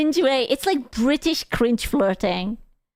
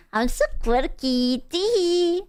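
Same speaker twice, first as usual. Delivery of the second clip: giggly tee-hee voice, higher on tee-hee; mock quirky girl; 5 semitones higher than usual. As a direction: play the cutesy quirky girl.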